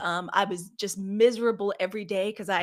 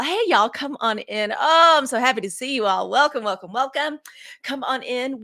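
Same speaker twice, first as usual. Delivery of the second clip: excited welcome; 4 semitones higher, 7 dB louder than usual. Real, not acted. Host greeting viewers joining live.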